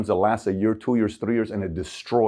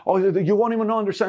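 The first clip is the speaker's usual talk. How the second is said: mock starstruck fan, higher and breathless; gushing fan; 10 semitones higher than usual. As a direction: ham up an overeager fan babbling at his hero.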